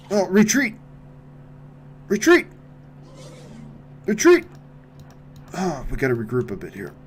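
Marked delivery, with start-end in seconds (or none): 0.1-4.4 high, loud yelled retreat; panicked shout. Real, not acted. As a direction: scream the order to fall back. Fleeing the monster.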